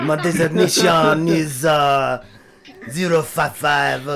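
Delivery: put-on loud French-accented robot voice. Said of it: "a bit of an accent, like a French accent".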